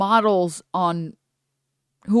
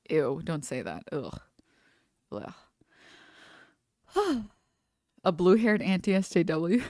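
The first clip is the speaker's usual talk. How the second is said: ordinary talk, nothing marked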